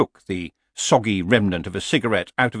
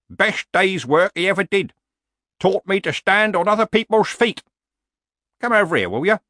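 put-on old warrior voice, higher, emphatic; gruffly proud old barbarian; 11 semitones higher, 4 dB louder than usual. Old barbarian defends his hard father, emphatically. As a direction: boast like an old fighter.

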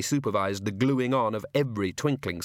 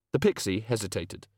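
ordinary delivery

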